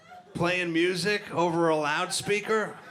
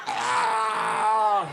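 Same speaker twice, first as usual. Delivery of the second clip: choking gasp; 5 semitones higher, 3 dB louder than usual. Retold or imitated. Choking husband's cry.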